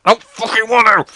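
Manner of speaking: high, loud retold whine of refusal; mock protest. Boy refusing the pushy rugby teacher, mock protest. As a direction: protest loudly, hammed-up. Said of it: "And I was like".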